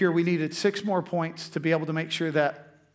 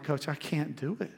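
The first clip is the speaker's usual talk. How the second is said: tearful plea; 8 dB quieter than usual. Retold or imitated. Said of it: "And man, I'm crying".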